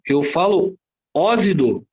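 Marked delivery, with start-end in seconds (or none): 1.1-1.9 "ósido" with a z sound for the x; wrong pronunciation demo. Shown as a demonstration. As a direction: say "ósido" slowly as "ózido". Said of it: "Não, eu não falo ósido".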